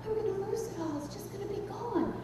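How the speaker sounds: high, very soft inner voice; soft lament. Retold or imitated. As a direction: mourn it quietly to yourself. Mourning her life in thought.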